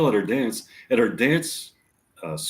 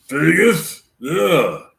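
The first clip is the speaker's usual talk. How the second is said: Al Pacino impression; 5 semitones higher, 5 dB louder, much slower than usual. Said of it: "does it sound like Al Pacino".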